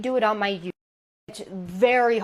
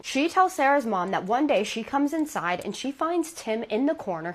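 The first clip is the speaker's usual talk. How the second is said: usual speaking voice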